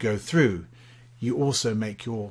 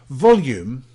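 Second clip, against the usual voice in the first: "Volume" punched out louder; loud emphasis; 3 semitones higher, 7 dB louder than usual. Shown as a demonstration. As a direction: hit "Volume" with extra force. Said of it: "you use the volume in your voice".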